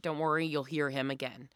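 ordinary delivery

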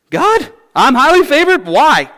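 high, disbelieving laugh of surprise; stunned girl who can't believe it's her; incredulous Mary. Retold or imitated. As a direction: squeak out who, me, in amazement. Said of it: "She was like".

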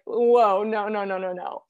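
startled whoa then no, no, no; startled refusal. Retold or imitated. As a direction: recoil with a loud whoa. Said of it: "And I was like".

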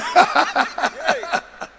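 long, high laugh; real laugh. Real, not acted. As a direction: burst of high laughter at his own joke. Laughs at own punching joke.

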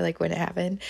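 ordinary delivery

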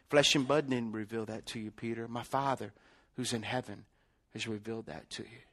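low, very soft reply to a disciple; solemn quiet Jesus. Retold or imitated. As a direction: voice Jesus gently, letting it sink away. Jesus solemnly answers Peter.

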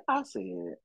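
ordinary talk, nothing marked

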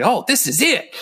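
mock party cheer. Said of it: "y'all at a party".

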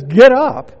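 loud, abrupt order; loud command. Retold or imitated. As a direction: bark it out. Quoting a blunt command.

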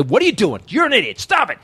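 retold father barking at his kid; imitating dad's nagging. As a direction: pitch rising sharply with each jab. Dad yelling at son, retold.

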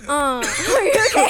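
speaking through laughter; laughing. Real, not acted. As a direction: talk while cracking up. Both laugh at coughing fit.

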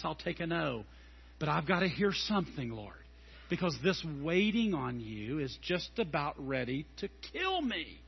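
low, soft, pleading prayer; quiet desperate prayer. Retold or imitated. Pastor alone in his office pleading with God, soft and low. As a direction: beg God quietly for any answer. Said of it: "I've sat in my office before as a pastor".

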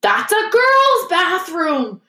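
high, drawn-out, scandalised outcry; shocked gasp. A narrator's reading. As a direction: exclaim it loudly in complete shock. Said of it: "he gasped".

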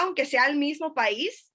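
ordinary talk, nothing marked

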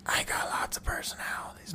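whispered as a faker's secret idea; whispering a made-up discovery; mock whisper. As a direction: hush it like a sly plan. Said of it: "they can all of a sudden".

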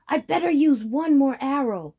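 high, loud determined voice; urgent. Acted. Boy deciding in danger.

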